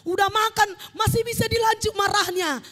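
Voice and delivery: animated, shouted exhortation; full-force preaching. Real, not acted. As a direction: exclaim to the congregation in scornful disbelief.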